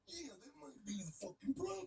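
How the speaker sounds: Macho Man impression. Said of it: "He's like".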